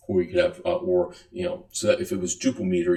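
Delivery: usual speaking voice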